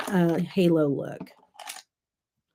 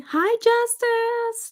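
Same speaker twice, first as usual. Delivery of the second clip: high, drawn-out hello; much higher, stretched-out welcome; excited greeting; 4 dB louder, much slower than usual. Real, not acted. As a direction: sing out a greeting to a friend. Greets a viewer by name.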